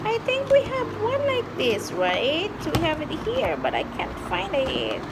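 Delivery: put-on high singsong for a toddler; reading to a toddler. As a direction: coax a small child gently.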